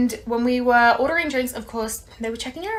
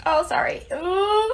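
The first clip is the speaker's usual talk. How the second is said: higher embarrassed apology then wince; sheepish apology; 8 semitones higher, slower than usual. Retold or imitated. Manager's repeated apology, sheepish and higher. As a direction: sound caught out.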